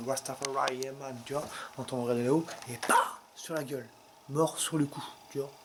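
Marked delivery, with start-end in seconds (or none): 2.7-3.2 sudden punchy "pah" for a blow; imitated impact noise. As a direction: mimic something smacking down hard. Imitates the iron falling on him. (bruit de choc imité, sec)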